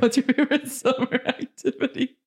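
laughing through the question; laughing repeat. Real, not acted. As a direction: ask it again, breaking into laughter. Repeats question after wrong answer.

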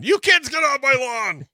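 high, loud hammed-up yell; mock angry yell. Joking about kids walking on the lawn, mock angry yell. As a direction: holler it like a cranky neighbour, in jest.

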